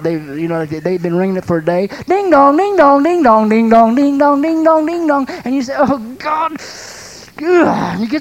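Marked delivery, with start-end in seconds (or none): 2.1-5.3 loud, high sing-song ding-dongs; insistent doorbell chimed over and over, louder and higher; doorbell imitation. Preacher mimics someone ringing a doorbell nonstop, imitated doorbell. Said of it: "start ringing that doorbell like you've been". 5.8-7.4 higher-pitched mock groan of annoyance; exasperated. Preacher acts out resident hounded by doorbell, exasperated.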